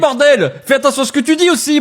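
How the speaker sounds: high-pitched indignant telling-off; mock outraged scolding. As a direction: scold back in feigned outrage. Caller scolds back in mock outrage.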